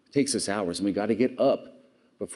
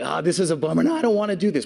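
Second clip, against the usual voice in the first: put-on whiny kids; 9 semitones higher, 6 dB louder, faster than usual. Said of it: "And the kids are like".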